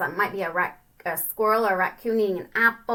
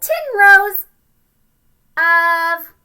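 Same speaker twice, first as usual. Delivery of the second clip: little ant voice; 6 semitones higher, 11 dB louder, much slower than usual. Retold or imitated. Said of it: "So he said".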